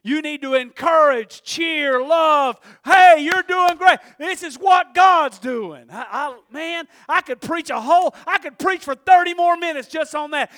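loud, excited preaching; full-force preaching. Real, not acted. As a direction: exhort them at full volume.

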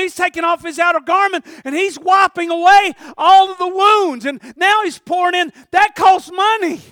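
high, loud astonished cry; amazed shout. Acted. Wounded man amazed at the Samaritan, shouting. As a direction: shout in wonder at the rescuer.

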